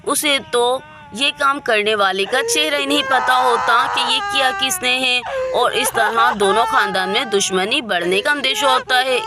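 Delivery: ordinary delivery, nothing marked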